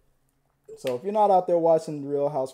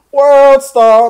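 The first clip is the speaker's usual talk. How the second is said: loud, high-pitched holler; mock triumphant shout; 10 semitones higher, 18 dB louder than usual. Gleeful bystander catching a scandal, shouting it out. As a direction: belt it out like calling over a crowd.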